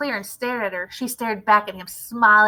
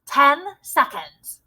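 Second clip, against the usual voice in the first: slow, louder countdown call; shouting; 4 semitones higher, 7 dB louder, much slower than usual. A narrator's reading. Witch shouts the countdown at the crowd. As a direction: bark out the seconds. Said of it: "shouted the Grand High Witch".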